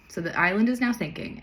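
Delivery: plain reading voice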